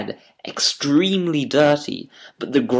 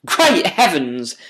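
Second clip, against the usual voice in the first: slow, astonished cry, higher and louder; cried out; 5 semitones higher, 5 dB louder, slower than usual. A narrator's reading. The inspector seeing the true face, crying out astonished. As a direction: draw it out in shock. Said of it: "cried the inspector".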